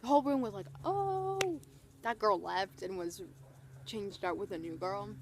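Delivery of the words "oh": high, drawn-out scandalized ooh; imitated crowd reaction. Whole room reacts to the sassing, high mock ooh. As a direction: pitch it up and hold it like a crowd.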